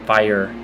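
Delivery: "Fire" as "FY-er"; two syllables. Shown as a demonstration. Showing viewers her way, "Fire" as "fy-er". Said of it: "Always two syllables".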